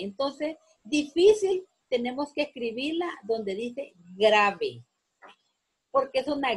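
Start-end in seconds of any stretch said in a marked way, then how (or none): 0.8-1.7 difícil with FÍ punched high and loud; penultimate stress. Shown as a demonstration. Teacher showing why difícil is grave, stressed on FÍ. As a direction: hit the middle syllable of difícil. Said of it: "es una palabra grave".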